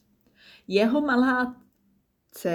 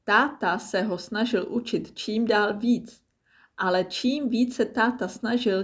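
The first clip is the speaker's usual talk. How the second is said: usual speaking voice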